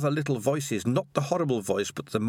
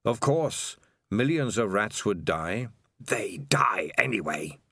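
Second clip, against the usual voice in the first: hissing whispered rat king voice; sinister whisper; 4 semitones lower than usual. Acted. Evil rat whispering to Dangerous Beans, hushed. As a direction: breathe it out like a lurking evil. Spider's creepy whispered reply.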